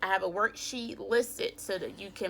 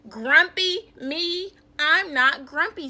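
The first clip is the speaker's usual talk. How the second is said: loud indignant protest, higher pitch; grumpy denial; 7 semitones higher, 9 dB louder than usual. Acted. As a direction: bark the denial, offended. Jim denies again.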